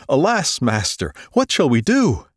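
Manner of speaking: high, loud panicked cry; alarmed cry. A narrator's reading. Servant seeing the army around the city, crying out to his master in alarm.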